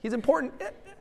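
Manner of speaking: put-on high, half-laughing shrug of a bored teen; lazy eh-eh of a teen shrugging off Jesus; mock shrugging indifference. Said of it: "looking at Jesus and saying".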